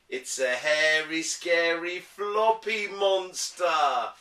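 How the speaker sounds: teasing reveal. Acted. Dad reveals Floppy teasingly.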